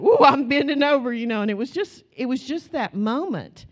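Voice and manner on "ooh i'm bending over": loud, higher giggly ooh; playful squeal. Retold or imitated. Passengers laughing along while bracing, loud and higher. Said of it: "They're like".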